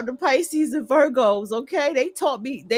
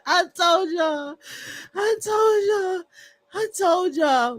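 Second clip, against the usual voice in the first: teary laughing; 5 semitones higher than usual. Real, not acted.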